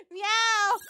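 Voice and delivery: high, loud kitten-like meow; squeaky cat meow. Pretend. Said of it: "keep meowing at Prometheus".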